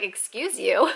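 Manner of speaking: mildly huffy, indignant retort; grumpy. Retold or imitated. Tired traveler snapping at someone who bumped her, grumpy. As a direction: toss it out in mock grumpiness. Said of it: "like, all grumpy".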